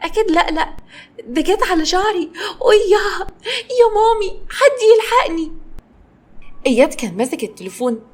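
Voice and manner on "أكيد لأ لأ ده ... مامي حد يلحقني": disbelieving, rising to a cry for mommy; shocked girl spiralling into panic. A narrator's reading. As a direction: pitch climbing higher into a panicked wail. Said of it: "كارمن بصت له بصدمة".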